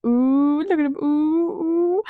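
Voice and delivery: playful gasp of gossip delight; mock excited ooh. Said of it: "I'm like".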